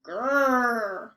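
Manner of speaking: short rolling grrr; bear growl. Acted. Bear growling as the old lady gives a scarf.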